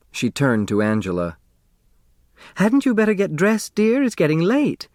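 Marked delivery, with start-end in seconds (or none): 2.6-4.9 high, sweet motherly nudge; fussing mother. A narrator's reading. Grace hurrying Angela to dress, high motherly voice. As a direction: coax her daughter along, higher.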